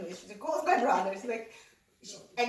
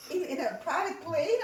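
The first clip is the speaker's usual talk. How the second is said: surprised; 7 semitones higher than usual. Retold or imitated. Mom's shocked question.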